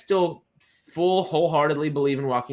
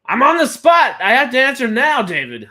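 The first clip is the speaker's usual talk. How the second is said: high, loud mock panic at being put on the spot; mock outrage; 11 semitones higher, 11 dB louder than usual. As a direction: playfully yell as if cornered. I'm kidding.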